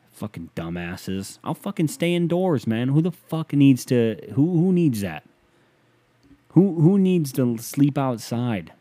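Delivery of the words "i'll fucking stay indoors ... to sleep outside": louder, higher exasperated rant; real irritation rising to a shout; angry rant. Real, not acted. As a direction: get worked up about camping. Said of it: "Sorry, I'm just getting angry".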